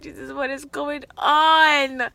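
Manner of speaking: loud, high, drawn-out retold disbelief; stunned exclamation. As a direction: stretch it out as a mock exasperated plea. Retold stunned plea to Jesus.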